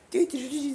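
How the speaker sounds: high, loud doo-doo game sound; arcade game noises. Acted. Imitating Star Warriors on the arcade, high beeping.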